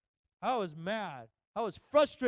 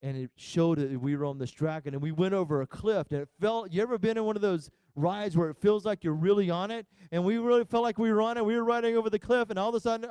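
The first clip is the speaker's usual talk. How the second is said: normal talking voice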